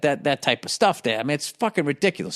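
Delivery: normal talking voice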